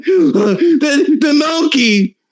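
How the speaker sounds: shrill hammed-up frightened woman; high squeaky mock panic. Answering the dispatcher in the video, high mock panic. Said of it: "woman answering dispatcher".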